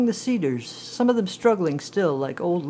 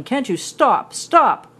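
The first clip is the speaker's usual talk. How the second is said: loud, high yell of panic; desperate yelling; 8 semitones higher, 7 dB louder, slower than usual. Retold or imitated. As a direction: scream for the tractor to stop. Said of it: "surprise and yelling".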